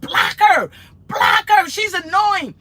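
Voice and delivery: high, loud mock holler; imitated hollering. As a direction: yell it in hammed-up exasperation. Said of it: "She was hollering at".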